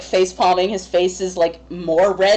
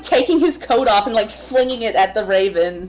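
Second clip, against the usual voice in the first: usual speaking voice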